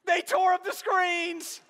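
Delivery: high, loud, slow lament; wife wailing over the damage. Retold or imitated. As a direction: cry it out in dismay. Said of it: "she was like".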